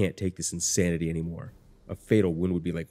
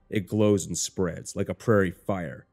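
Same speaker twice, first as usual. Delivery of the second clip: ordinary delivery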